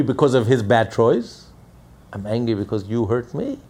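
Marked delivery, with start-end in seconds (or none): none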